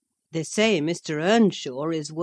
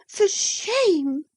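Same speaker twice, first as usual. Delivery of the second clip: angry repeat; 3 dB louder than usual. Acted. Isabella repeating her rebuke, high and hurt. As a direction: repeat it, still indignant. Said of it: "she repeated angrily".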